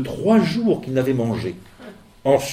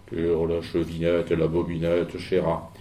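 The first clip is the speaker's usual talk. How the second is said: wolf's voice softened, lower and quieter; the wolf faking gentleness, low and hushed; voix du loup adoucie; 8 semitones lower, 6 dB quieter than usual. Acted. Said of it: "en adoucissant un peu sa voix". (le loup déguise sa voix, plus grave et plus douce)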